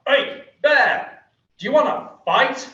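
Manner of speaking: high, loud challenge across the room; taunting call. Retold or imitated. Jukebox voice daring the bear to fight, high loud taunt. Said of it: "this voice comes across the bar".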